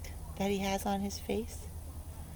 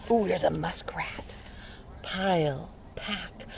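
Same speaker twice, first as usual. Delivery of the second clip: whisper; slower than usual. Acted. Teacher whispering about the muskrat. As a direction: point it out secretly.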